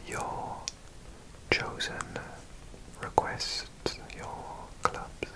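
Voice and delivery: very hushed ASMR whisper; soft whisper. Real, not acted. Presenting viewers' requested clubs, very soft whisper. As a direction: let each phrase fall to a whisper.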